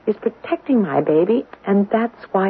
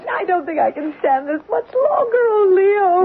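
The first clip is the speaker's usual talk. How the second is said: distressed; 10 semitones higher than usual. Acted. Wife overwhelmed by ghost's presence.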